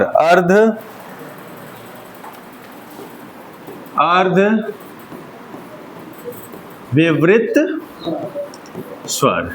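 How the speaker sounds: अर्ध, विवृत, स्वर each said alone with long pauses; word by word. Shown as a demonstration. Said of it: "क्या नाम है?".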